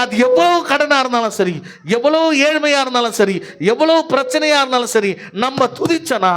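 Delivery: high, insistent pulpit proclamation; fervent revival-meeting preacher; full-force preaching. Real, not acted. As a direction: rouse the congregation with driving, repeated declarations. Sermon rises to full force.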